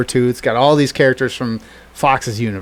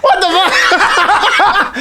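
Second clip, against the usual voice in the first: much higher, very slow, laughing "what the fuck"; laughing disbelief; 4 dB louder, much slower than usual. Retold or imitated. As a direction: stretch it out through laughter. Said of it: "and you're like".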